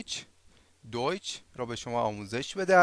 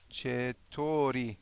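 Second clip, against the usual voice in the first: "چطوری" stretched out syllable by syllable, softly; very slow; 3 semitones lower, 6 dB quieter, much slower than usual. Shown as a demonstration. Teacher introduces the next word, "چطوری" very slow and soft. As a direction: draw out each syllable of "چطوری" quietly.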